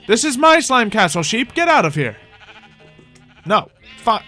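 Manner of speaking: ordinary talk, nothing marked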